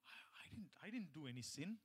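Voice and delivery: hushed, puzzled self-questioning; soft, near-whispered inner voice; wondering to oneself. Acted. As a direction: voice a private doubt very softly. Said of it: "So sometimes we're wondering".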